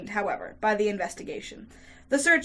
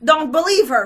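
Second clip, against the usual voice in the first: loud, raised urgent shout; 6 semitones higher, 12 dB louder than usual. A narrator's reading. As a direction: shout in desperate defence of her. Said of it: "he shouted".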